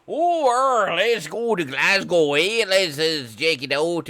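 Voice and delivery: loud, high hammed-up Scottish accent; over-the-top Scottish brogue; mock Scottish accent.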